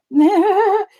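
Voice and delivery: high, loud burst of no; hurried mock refusal. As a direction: fend it off in a high, quick panic. Mock-panicked retold refusal.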